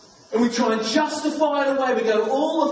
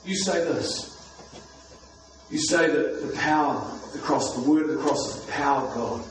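spoken prayer; 4 semitones lower than usual. Acted. Leads the church in prayer.

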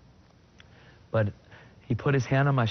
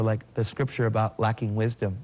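usual speaking voice

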